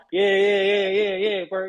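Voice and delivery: retold brush-off of a suspected prankster; scoffing disbelief. Man who thinks his mate is pranking him, scoffing.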